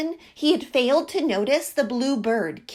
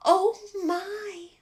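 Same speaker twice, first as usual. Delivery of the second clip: apologetic little bird; 8 semitones higher, slower than usual. A narrator's reading. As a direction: say sorry meekly, a small bird's voice. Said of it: "apologized the bluebird".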